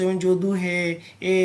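যদু, হে, এ with the vowels dragged long; drawn-out vowels. Shown as a demonstration. Teacher showing drawn-out vowels to students, dragging each vowel long. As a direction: stretch each vowel as if calling out. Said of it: "এরকম টেনে টেনে উচ্চারণ করি".

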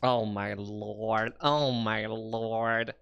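very slow, low, drawn-out exclamation; stunned awe. Real, not acted. Streamer marvelling at an animated butt, slow low awe. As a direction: stretch each word out in amazement.

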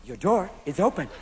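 talking car. Acted. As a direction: announce it like an automatic car voice. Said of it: "Japanese cars where you open the door and it goes".

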